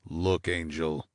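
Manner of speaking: soft, slow, low snarl; a quiet menacing snarl. Acted. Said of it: "Morney snarled".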